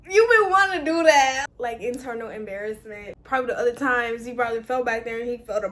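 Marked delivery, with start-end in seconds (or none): 0.1-1.5 louder, higher teasing callout; loud reaction. Acted. Reacting to him touching her hair, loud and high. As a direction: belt it out, playfully exposed.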